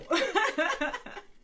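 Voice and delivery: a high, rising chuckle; amused laugh. Real, not acted. Pointing out neighbor's hypocrisy, amused chuckle. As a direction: laugh at the irony.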